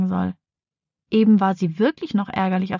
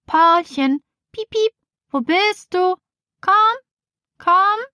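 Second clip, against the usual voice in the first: high, slow coaxing calls, rising at the end; calling for the bird; 11 semitones higher, 3 dB louder, slower than usual. Acted. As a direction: sweetly lure the budgie out of hiding. Girl searching for her budgie.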